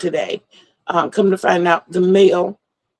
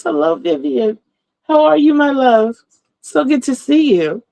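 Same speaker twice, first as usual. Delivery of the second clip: high, bright hello to a viewer; excited greeting; 6 semitones higher than usual. Real, not acted. Greeting viewer in chat warmly.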